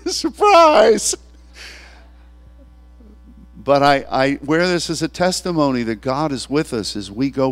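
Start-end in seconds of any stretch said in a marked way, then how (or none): 0.1-1.2 high, loud gleeful shout; surprise shout. Retold or imitated. Man on the ground to God, gleeful high shout. Laughing cry after attack.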